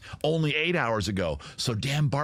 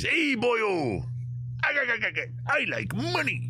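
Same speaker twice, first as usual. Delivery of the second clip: much higher, cartoon crab captain; Mister Krabs impression; 11 semitones higher than usual. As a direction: squeak it out like a greedy cartoon crab. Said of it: "Mister Krabs is, is".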